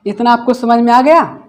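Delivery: usual speaking voice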